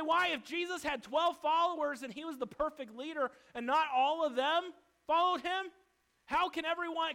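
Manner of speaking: loud, forceful pulpit preaching; a preacher raising his voice at the congregation; fiery preaching. Acted. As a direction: thunder it from the pulpit. Preaching at full force again.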